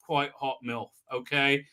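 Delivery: ordinary delivery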